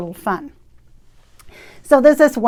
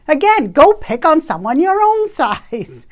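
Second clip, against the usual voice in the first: mock indignation; 5 semitones higher, 4 dB louder than usual. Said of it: "I'm really mad".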